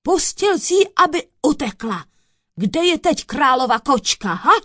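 loud, high interrogation; lashing out. A narrator's reading. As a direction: demand answers at full voice. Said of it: "uhodil soudce na kouzelníka".

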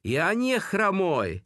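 high, drawn-out protesting cry; acted prisoner pleading innocence; desperate protest. A narrator's reading. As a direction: protest slowly with pitch pushed high. Prisoner protesting under interrogation.

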